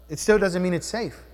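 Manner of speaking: ordinary talk, nothing marked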